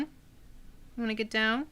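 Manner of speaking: high, slow, soft coo; cat talk. Real, not acted. Streamer asking her cat softly if it wants down. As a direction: ask the cat gently, drawn out.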